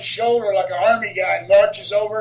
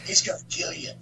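whispered retold warning; hushed warning; 9 dB quieter than usual. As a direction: warn him under your breath. Scared wrestlers warn him in story.

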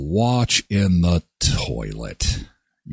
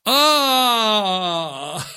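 laughing squeal; 9 semitones higher than usual. Real, not acted. Laughing scream after story.

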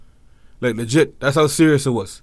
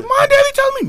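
much higher, pleading younger brother; brother's voice. Retold or imitated. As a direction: beg the sister for the secret.

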